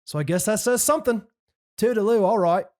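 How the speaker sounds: hammed-up English accent from London; cheeky Londoner voice, read with gusto. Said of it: "I'm gonna say it in his accent".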